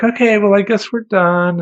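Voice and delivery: high, loud chirpy wrap-up; clerk voice. Retold or imitated. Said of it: "She's like".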